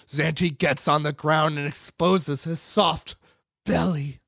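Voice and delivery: plain reading voice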